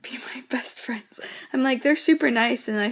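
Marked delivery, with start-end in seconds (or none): 0.0-1.2 whispered with a laugh, much softer. Real, not acted. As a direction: whisper it like a giggly secret. Joking about the freshman girls.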